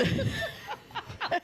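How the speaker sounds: shrieking burst of laughter up high; real high-pitched laughing fit; high squealing laugh. Real, not acted. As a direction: squeal with delight. Laughing at imitation.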